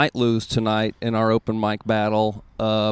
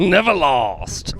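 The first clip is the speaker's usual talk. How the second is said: LeVar Ball impression; 3 semitones higher, much slower than usual. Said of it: "LeVar Ball-esque".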